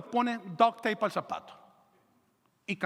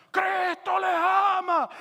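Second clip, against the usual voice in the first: drawn-out, high, loud shout; 10 semitones higher, 5 dB louder, much slower than usual. Acted. Preacher calling to a crowd without a microphone, shouting. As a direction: yell it out slowly to a crowd from a second floor. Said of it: "empieza a gritar".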